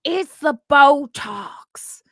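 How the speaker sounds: slow mock movie star delivery; Jennifer Coolidge line. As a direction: drag it out like a movie diva. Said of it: "I was doing the".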